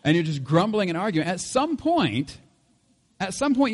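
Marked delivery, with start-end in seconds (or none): none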